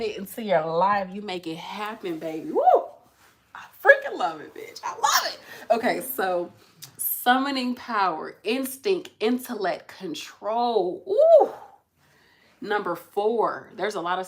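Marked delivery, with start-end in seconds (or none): 2.5-5.4 high, loud squeal of delight; thrilled high whoop; excited. Real, not acted. Excited reaction to card. 11.1-11.5 high loud delighted ooh; thrilled coo; excited ooh. Real, not acted. Excited reaction to card.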